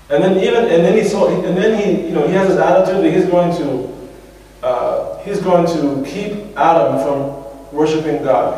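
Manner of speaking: usual speaking voice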